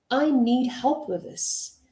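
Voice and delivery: slow, concise, unhurried statement; clear, direct request. Shown as a demonstration. Coach models asking for help at work, clear and direct. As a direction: state the need plainly, slowly and firmly. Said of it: "is much more clearer. It is concise".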